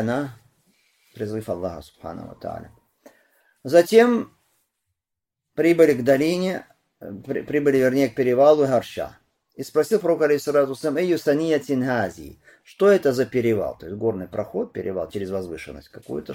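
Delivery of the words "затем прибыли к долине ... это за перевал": high, loud narration; raised preacher's voice; hadith recitation. A narrator's reading. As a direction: project the hadith to the congregation. Recites and translates hadith aloud.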